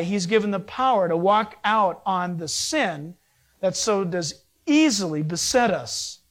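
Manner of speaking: usual speaking voice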